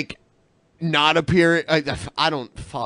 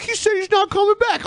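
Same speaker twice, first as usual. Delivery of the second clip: much higher mocking voice; mock high quote. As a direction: squeak out his words to mock him. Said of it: "when you were like".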